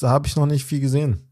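ordinary talk, nothing marked

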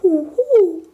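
high loud goblin hoot; owl hoot. Acted. Night goblin hoots in surprise at the wisp. Machte der kleine Nachtalb.

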